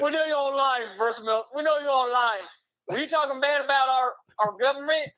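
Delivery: high-pitched hammed-up Aussie caller; mock Australian accent. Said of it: "Am I doing the right Australian accent".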